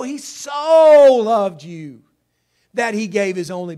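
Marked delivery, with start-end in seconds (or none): 0.0-2.0 "so" stretched long and loud; stress on SO. Shown as a demonstration. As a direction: belt out a long, raised "so". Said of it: "You've got to accent the word".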